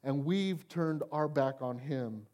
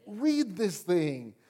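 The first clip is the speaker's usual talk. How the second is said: slow emphatic; much slower than usual. Real, not acted. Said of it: "slow emphatic command".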